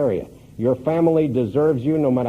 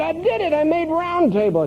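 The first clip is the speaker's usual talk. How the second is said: much higher, triumphant cry; all excited. Retold or imitated. Woody phoning Norman with his news, excited. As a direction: burst out with the big news. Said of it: "all excited".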